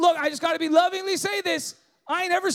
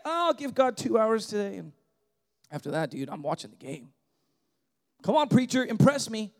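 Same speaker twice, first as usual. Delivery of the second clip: bored churchgoer; 8 semitones lower than usual. Pretend.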